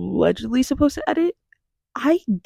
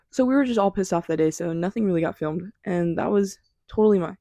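ordinary talk, nothing marked

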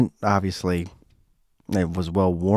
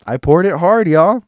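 ordinary talk

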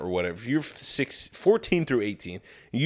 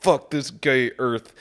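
slowed, put-on voice for the doomed loner; hammed-up tragic line; 2 semitones higher, 3 dB louder than usual. Repeating the punchline to mock its fake emotion, hammed-up. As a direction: savour it like a cheesy emotional climax. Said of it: "it's supposed to make you feel really emotional".

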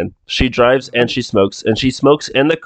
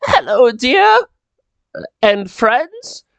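put-on much higher-pitched elderly maid voice; old maid; 13 semitones higher than usual. Old maid greets visitors at the house, high polite old-lady voice. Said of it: "she's old and she's, like, holding a cane".

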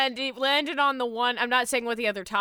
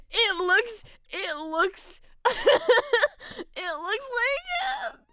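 high, drawn-out words breaking into laughs; cracking up mid-sentence; helpless laughter; 8 semitones higher, 3 dB louder, slower than usual. Real, not acted. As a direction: struggle to get it out through real laughter. Helpless laughter over a resemblance.

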